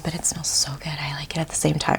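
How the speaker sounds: sudden soft whisper. Real, not acted. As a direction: drop to a hushed whisper about the smell. Sniffing the moisturizer, hushed aside.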